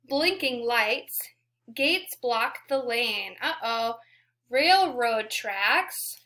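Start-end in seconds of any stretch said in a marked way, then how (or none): 4.5-6.2 slow clear word. Shown as a demonstration. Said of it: "teaching vocabulary".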